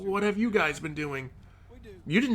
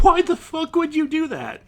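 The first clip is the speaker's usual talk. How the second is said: high, louder incredulous outburst; real exasperation, voice pitched up; exasperated; 7 semitones higher, 4 dB louder than usual. Real, not acted. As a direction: demand an answer in disbelief. Exasperated at character's choices.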